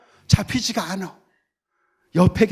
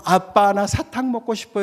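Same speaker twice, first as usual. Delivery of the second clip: put-on cute child; 7 semitones higher than usual. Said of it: "이러면 귀엽잖아요".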